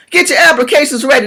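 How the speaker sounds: ordinary talk, nothing marked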